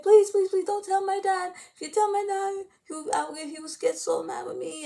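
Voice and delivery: high-pitched imitated begging; pleading. Begging not to tell dad.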